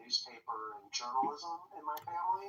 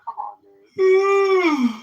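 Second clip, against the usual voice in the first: drawn-out mock yell; 16 dB louder, much slower than usual. Arguing over ten bucks.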